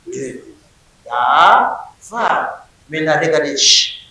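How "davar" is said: Davar pronounced slowly, da-var, two clear syllables; syllables spelled out. Shown as a demonstration. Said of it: "davar a combien de syllabes". (Davar prononcé lentement en deux syllabes)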